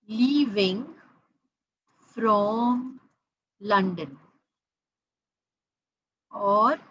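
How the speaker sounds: each word said slowly with pauses; slow, spaced-out demo. Shown as a demonstration. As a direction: spell the example out word by word. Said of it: "What do I mean?".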